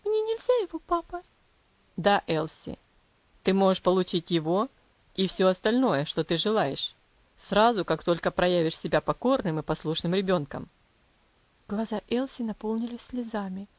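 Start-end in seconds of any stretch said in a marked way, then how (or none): 0.1-1.2 put-on high, pleading little girl voice; девочка Элси просит. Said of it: "она спросила опять". 2.0-10.7 low, firm father's voice; stern father. Acted. Stern father answers daughter.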